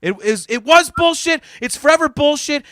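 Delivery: ordinary talk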